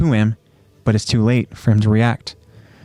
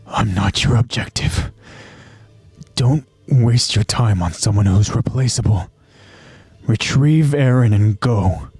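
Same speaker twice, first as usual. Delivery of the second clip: acted commander giving a last order; Commander Irvin. Injured commander tells his scout to leave him, grave order. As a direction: put on a grave, deliberate commander's voice, drawing out the final order. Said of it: "Commander Irvin tells his scout".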